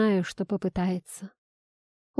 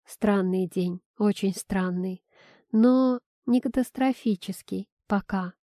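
usual speaking voice

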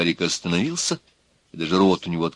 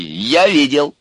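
slightly raised, emphatic Odessa intonation; Odessa accent; 7 semitones higher, 6 dB louder, slower than usual. A narrator's reading. Urka confirms he witnessed it, Odessa accent. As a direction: repeat it with a touch of Odessa. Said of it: "повторил по-одесски урка".

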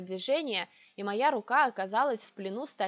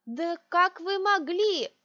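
outraged shout; 8 semitones higher, 6 dB louder, slower than usual. A narrator's reading. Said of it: "Мой вопль разнёсся".

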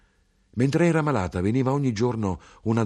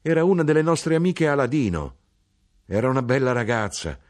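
higher, lively mother, a touch louder; mother's voice; 6 semitones higher, 4 dB louder than usual. Acted. Mother telling her son about the girls. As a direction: voice the mother, bright and eager.

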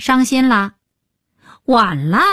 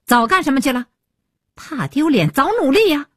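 high-pitched scolding mother; berating a child, pitched up; scolding loudly; 7 semitones higher than usual. A narrator's reading. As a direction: scold with mounting indignation. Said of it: "愈发的放开了喉咙".

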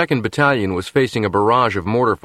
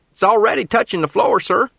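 strained corporal; 13 semitones higher than usual. Acted. Corporal protesting while speeding.

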